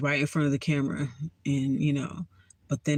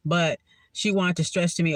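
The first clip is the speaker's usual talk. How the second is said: usual speaking voice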